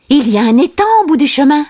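high, rapid character line; quick character voice. A narrator's reading. A friend points the way to the pond, high and quick. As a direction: say it high and fast.

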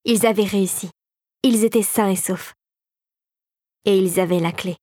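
usual speaking voice

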